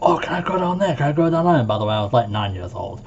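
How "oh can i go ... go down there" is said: put-on childish asking. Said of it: "asking them".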